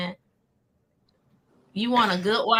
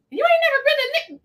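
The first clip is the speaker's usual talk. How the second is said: higher, incredulous quoted friend; mock disbelief. Friend can't believe she's never been to the club, mock disbelief. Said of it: "She was like".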